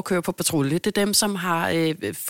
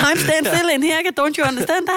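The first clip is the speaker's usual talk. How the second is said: high, loud, exasperated complaint; hammed-up frustrated customer, louder and higher; mock exasperated outburst; 11 semitones higher, 5 dB louder than usual. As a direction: throw up your hands at the waiting. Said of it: "Hvor det er sådan".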